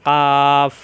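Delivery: loud, hard "কাফ" with an open "আ"; "কাফ" struck firmly, just forward of the tongue root; firm articulation. Shown as a demonstration. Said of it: "শক্ত করে".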